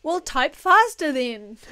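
loud joking yell. Real, not acted. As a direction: shout it at the chat.

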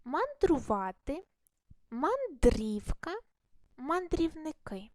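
мандрувати and мандрівка read slowly, each word set apart; slow model reading. Shown as a demonstration. Teacher reading sample words for pupils, slow and deliberate. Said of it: "спільну частину в словах".

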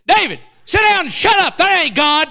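loud, high shouted order; stern father-in-law's holler; blunt rebuke. Retold or imitated. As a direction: shout him down in front of the church. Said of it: "No, he said".

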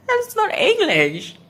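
high laughing disbelief. Real, not acted. Laughing at bad translation.

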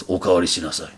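low, understated master's order. Retold or imitated. Master ordering a student to take seconds, low voice. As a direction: lay down the order low and unhurried. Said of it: "So he goes".

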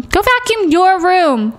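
mock nagging parent, stretched slowly; stern parent order. Shown as a demonstration. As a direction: drag it out like a fed-up mom.

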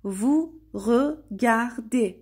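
"vous regardez" very slowly, each syllable set apart. Shown as a demonstration. Teacher showing learners "vous regardez", syllable by syllable. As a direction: break "vous regardez" into separate, drawn-out syllables.

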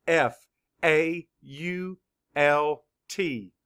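F A U L T spelled out letter by letter, slowly; teacher spelling F A U L T for children. Shown as a demonstration. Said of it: "Spell it".

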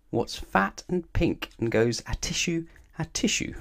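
slow, puzzled repeat; worried. A narrator's reading. Pig repeating Mister Dizzy's riddle, slow and worried. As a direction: echo it hesitantly, a touch anxious. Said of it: "looking worried".

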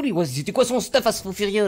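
ordinary talk, nothing marked

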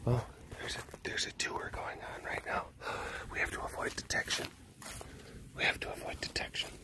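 hushed secret-agent whisper; mock stealth whisper. Derek hides from the tour group, mock stealthy whisper. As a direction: whisper like sneaking past guards. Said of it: "hiding from tour".

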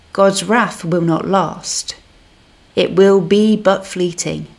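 normal talking voice

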